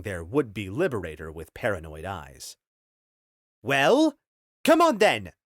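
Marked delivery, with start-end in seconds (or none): 3.6-5.3 high, loud impatient shout. Acted. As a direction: yell at them to move. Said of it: "Henry shouted at the slaves".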